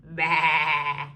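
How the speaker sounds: low bleating bah; sheep baa. Pretend. Reading to children, a low sheep bleat.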